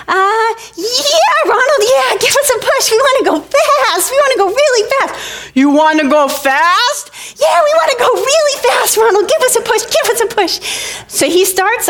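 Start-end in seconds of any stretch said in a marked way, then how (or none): 0.1-5.1 put-on very high, squealing child voice; hammed-up kid excitement; excited little girls. Excited little girls begging for push. 5.5-7.1 put-on slow, teasing big-boy question; slightly scary older boy, acted; Ronald Foster. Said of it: "just a tiny bit scary". 7.4-10.6 put-on very high, squealing child voice; hammed-up kid excitement; excited little girls. Excited little girls begging for push.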